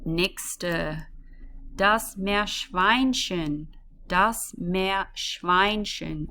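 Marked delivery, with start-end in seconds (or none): 1.7-3.7 slow and careful. Shown as a demonstration. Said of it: "this is a bit of a mouthful". 4.1-6.3 "Meerschweinchen" drawn out, syllable by syllable; deliberate teacher model; slow and careful. Shown as a demonstration. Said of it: "this is a bit of a mouthful".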